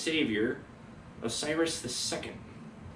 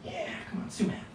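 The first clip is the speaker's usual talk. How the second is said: whispered to cat; 6 dB quieter, faster than usual. Real, not acted. Hushed to the cat.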